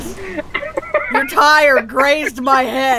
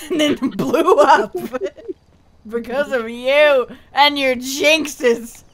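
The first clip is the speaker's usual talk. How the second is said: normal talking voice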